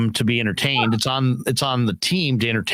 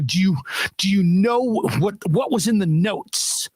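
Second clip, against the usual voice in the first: mock angry stylist; 5 semitones higher than usual. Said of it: "getting a little kind of angry".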